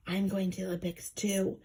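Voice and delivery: secretive breathy whisper; detective's hushed confidence. A narrator's reading. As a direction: whisper so nobody overhears. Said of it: "he whispered at last".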